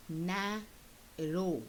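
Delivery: "narrow" in two beats, NA, long pause, RROW; split into syllables. Shown as a demonstration. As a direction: break "narrow" in the middle. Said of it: "the way you say the word itself".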